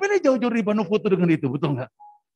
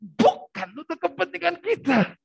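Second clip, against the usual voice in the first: slow, emphatic sermon delivery, mostly high; rousing preacher driving the message home; impassioned preaching; 6 semitones higher than usual. Real, not acted. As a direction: preach it out in long, measured phrases.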